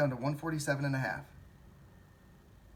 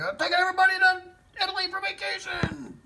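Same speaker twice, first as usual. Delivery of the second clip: ecstatic shout; 10 dB louder than usual. Retold or imitated. Imitating rich shareholders bragging, a huge ecstatic yell. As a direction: crow it like a lottery winner.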